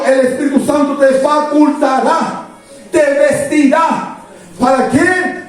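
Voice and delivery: high, ringing pulpit voice that drives each phrase home; preaching at full force. Acted. Pastor exhorts his church to wait for power, fervent preaching. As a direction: proclaim it to the congregation with fervour. Preacher raises pitch, emphatic.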